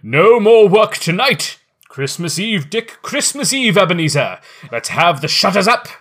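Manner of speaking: put-on loud, hearty jolly old boss; jovial fat voice. A narrator's reading. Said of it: "cried old Fezziwig".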